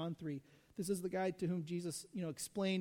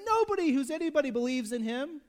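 higher, louder elitist sneer; snobbish scorn; 6 semitones higher, 7 dB louder than usual. Retold or imitated. Preacher mimicking elitist Pharisees, higher and louder sneer. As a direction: dismiss him like a snob. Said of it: "But they're saying".

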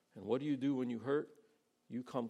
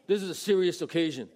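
louder, pompous mock seriousness; mock stern disciple; 5 semitones higher, 7 dB louder than usual. Disciples shooing babies away, mock pompous. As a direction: play the self-important disciple.

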